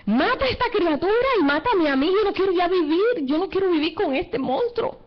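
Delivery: high-pitched anguished plea, retold; a mother's retold despairing cry, high; desperate cry to God. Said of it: "le gritó así al Señor".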